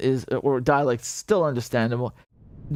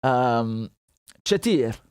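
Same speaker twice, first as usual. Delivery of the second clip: a light Iraqi accent demo; Iraqi ch sound; 3 semitones lower, much slower than usual. Shown as a demonstration. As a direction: show the Iraqi ch in "Pebich". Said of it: "they use the ch a lot".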